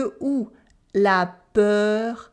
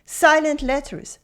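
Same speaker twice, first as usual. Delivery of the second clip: ordinary talk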